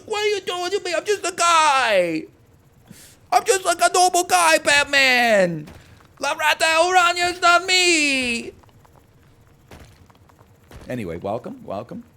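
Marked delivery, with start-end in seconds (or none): 0.0-8.5 very high, loud, wailing yell; hammed-up hysterical protest. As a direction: plead innocence in an exaggerated screech. Mock hysterical protest of innocence.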